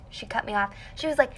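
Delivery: ordinary talk, nothing marked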